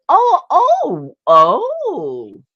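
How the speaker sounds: high, loud gushing oh oh oh; retold burst of delight, loud and high; excited thanks. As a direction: gush over it, delighted. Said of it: "I said".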